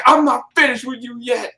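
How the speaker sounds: high-pitched mock threat; imitated menacing wrestler. Wrestler's voice heard down the hall, imitated menacing call. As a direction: call it out like a looming monster wrestler. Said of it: "you heard that voice say".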